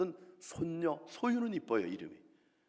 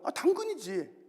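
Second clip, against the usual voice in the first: much higher, breezy pun; playful high answer; 12 semitones higher, 6 dB louder, slower than usual. Acted. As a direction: toss it off high and cheeky.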